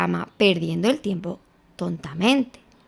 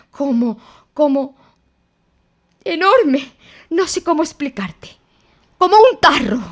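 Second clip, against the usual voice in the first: high, loud, stumbling excited words; frantic description; 4 semitones higher, 4 dB louder than usual. A narrator's reading. Piglet to Christopher Robin, frantic and scared. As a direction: describe the monster, struggling for words.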